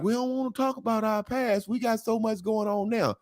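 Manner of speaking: high, airy caricature voice. Retold or imitated.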